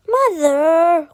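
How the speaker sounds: loud, falling cry of embarrassment; embarrassed protest. A narrator's reading. Son protesting his mother's embarrassing question. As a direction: cry out in mock outrage. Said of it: "cried the boy".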